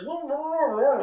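high, loud startled whoa; hammed-up startled exclamations; mock surprise. Acting his own reaction.

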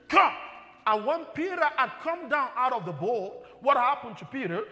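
rising, loud fervent preaching; full-force preaching. Acted. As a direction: build the congregation up with each verse.

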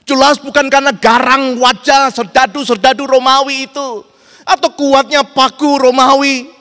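very loud, high-pitched pulpit questioning; soaring, forceful sermon climax; impassioned preaching. Real, not acted. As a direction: drive each question home at full force.

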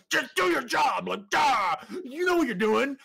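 high-pitched exasperated shout; mad outburst. Retold or imitated. Mad firefighter retelling how he answered new firefighters, exasperated shout. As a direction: snap at them in a drawn-out, strained yell. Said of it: "I was mad".

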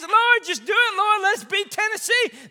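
high, loud mock game-day prayer; imitated fan plea. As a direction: plead like a football fan begging for a win. Imitates fans praying for a win.

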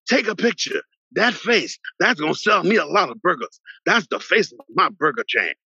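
high, eager order to the photographer; hammed-up champ spotting his new face; excited Ali.

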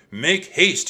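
high, loud command; urgent order. A narrator's reading. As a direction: rouse everyone to hurry. Monk urgently ordering the others.

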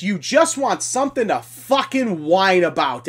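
ordinary talk

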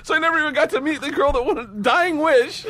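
high, laughing disbelief. Real, not acted. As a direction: laugh through the words. Laughing at the punchline.

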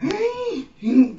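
high frightened gasp; desperate gasp. Retold or imitated. Imitating a stoned friend panicking in desperation, gasping. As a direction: mimic a terrified intake of breath.

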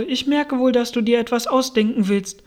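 suspicious pigeon. A narrator's reading. Pigeon suspects Alice is inventing something, accusing.

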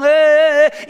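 retold crowd shout; market crowd yelling. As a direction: yell like a brawling market crowd. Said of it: "todos gritaban".

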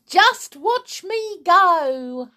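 high, slow, loud boast; calling down. A narrator's reading. Grandma boasts down to George, loud exultant call. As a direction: show off, calling from above. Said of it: "she called down to George".

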